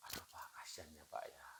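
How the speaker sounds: fast, hushed pity; a soft, sorry murmur; sympathetic whisper. Retold or imitated. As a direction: whisper your sympathy to the speaker. Listener reflects feeling sympathetically.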